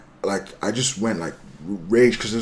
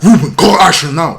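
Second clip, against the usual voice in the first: loud, slow, raised command; urgent retold order; 5 semitones higher, 10 dB louder than usual. Ordering his mate Ruben to make the call, urgent shout.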